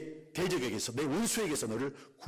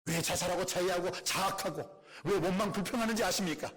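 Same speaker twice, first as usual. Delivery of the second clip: normal talking voice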